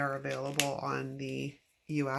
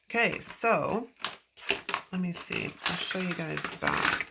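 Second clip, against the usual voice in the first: usual speaking voice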